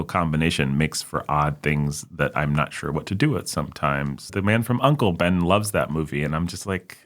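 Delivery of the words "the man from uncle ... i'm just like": ordinary talk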